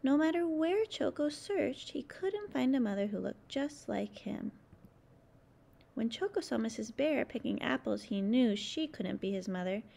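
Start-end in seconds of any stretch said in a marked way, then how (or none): none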